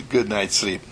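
usual speaking voice

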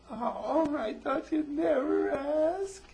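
high, loud, drawn-out mock whine; itsy-bitsy baby voice. Woman accepting a movie date, exaggerated childish high voice. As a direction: squeal it slowly in a hammed-up childish voice. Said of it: "some little itsy-bitsy mind".

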